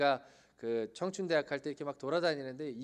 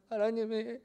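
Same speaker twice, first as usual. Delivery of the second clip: retold husband; 6 semitones higher, 5 dB louder than usual. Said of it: "막 이랬을 거예요".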